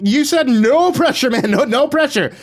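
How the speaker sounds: high, loud, flustered protest; frantic high-pitched yelling; panicked yell. A narrator's reading. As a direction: yell it across the room in alarm. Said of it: "I yelled".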